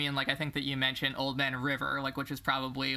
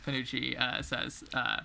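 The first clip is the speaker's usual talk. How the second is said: ordinary talk, nothing marked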